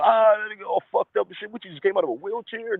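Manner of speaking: put-on high, jeering voice of the boys; mocking friends. Friends jeering at an injured guy, high and mocking. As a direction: ridicule him like a heckler. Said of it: "they talking about".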